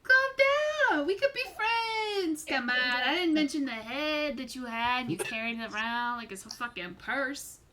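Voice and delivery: mock innocent stranger calming a threat; placating charmer. NPC placates angry player.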